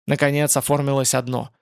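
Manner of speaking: plain reading voice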